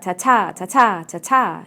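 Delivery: "ta-ta" with a weak first ta and a strong, longer second; rhythmic weak-strong beat. Shown as a demonstration. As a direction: beat out "ta-ta" like a pulse, second syllable punched. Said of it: "its rhythm and its beat".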